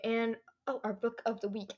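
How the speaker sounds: ordinary talk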